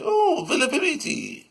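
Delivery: very high mock Irish accent; exaggerated put-on Irish lilt. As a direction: squeal out a comic Irish impression. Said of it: "We don't talk with an Irish".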